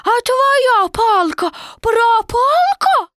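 put-on high, loud, drawn-out child; a small joey worried his stick is lost; squeaky fretful kid; little Roo voice. A narrator's reading. Roo voice continues.

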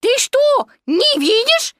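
high, squealed piglet outburst; shouting. A narrator's reading. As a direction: cry out in squeaky indignation. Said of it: "закричал Пятачок". (писклявый крик)

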